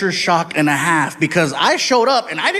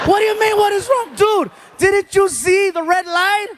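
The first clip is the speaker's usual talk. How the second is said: very high-pitched, outraged disbelief; incredulous yelling; 13 semitones higher than usual. Acted. Passenger to driver who ran a red light, incredulous yelling. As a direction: yell back in frantic disbelief.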